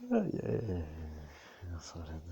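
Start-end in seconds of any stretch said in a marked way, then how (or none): 1.8-2.2 Sorin said low and much softer; soft low murmur. Acted. Repeats the name softly.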